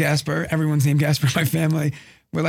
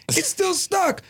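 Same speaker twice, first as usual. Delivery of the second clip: high imitated little boy; put-on kid complaint, pitched way up; little son; 13 semitones higher, slower than usual. Son to dad from the bathroom, high kid voice. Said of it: "He's like".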